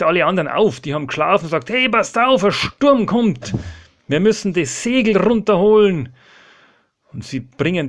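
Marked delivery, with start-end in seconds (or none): 1.7-6.1 loud, high alarm to the crew; urgent warning call. Acted. Petrus wakes the sleeping men as the storm comes, urgent shout. Petrus weckt die anderen im Sturm.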